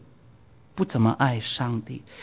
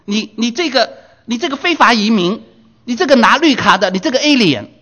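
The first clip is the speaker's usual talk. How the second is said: high, loud jeering at the foreigner; mocking insults; 8 semitones higher, 6 dB louder than usual. Townsmen jeering at Lot, high loud scornful insults. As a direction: hurl the insults like a hostile crowd. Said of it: "可是那些人呢骂他".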